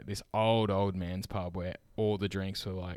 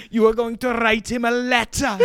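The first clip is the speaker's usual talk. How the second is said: high, loud imitated boss yelling; hammed-up furious boss; mock yell; 13 semitones higher, 9 dB louder than usual. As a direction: bellow it like an angry sports boss. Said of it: "He's like".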